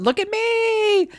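mock high-pitched cry for attention; hammed-up, drawn-out showing off; desperate attention-seeking. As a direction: stretch it out in a needy, high singsong. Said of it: "it just seemed desperate because everything she was doing was about".

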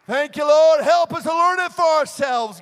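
high, loud cry of praise; fervent praying aloud. Real, not acted. Preacher asks God to help them learn, fervent loud prayer. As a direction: cry out the prayer over the congregation.

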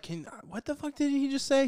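a baffled what-did-he-say burst; baffled outburst. Retold or imitated. Viewer baffled by mumbled line, high incredulous outburst. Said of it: "you're like".

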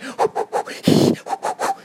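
hard unvoiced breath pushed out of the mouth; forceful blow out. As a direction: blow the smoke away with a sharp breath. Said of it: "breathing out of my mouth like".

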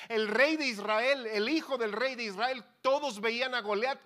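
loud, high-pitched pulpit delivery; preaching crescendo. Real, not acted. Preacher tells congregation how all Israel saw Goliath, loud and emphatic. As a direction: drive each phrase out loudly. Preacher building the story's climax.

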